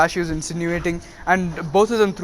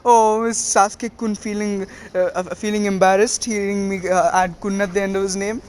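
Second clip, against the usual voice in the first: mock-sympathetic teasing; 3 semitones higher than usual. Naruto teasing Sasuke over his nickname, mocking coo. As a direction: tease with pretend concern, lilting up at the end. Boy teases rival about nickname.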